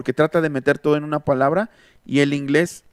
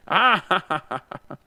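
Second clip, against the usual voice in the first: villainous cackle, high then fading; evil laugh; 4 semitones higher than usual. Retold or imitated. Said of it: "se reían con una risa malvada".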